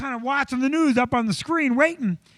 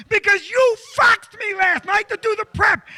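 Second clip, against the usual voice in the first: loud, high-pitched outburst; angry accusation; 8 semitones higher, 5 dB louder than usual. Retold or imitated. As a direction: yell the blame back at the nurse. Acted quarrel with the nurse.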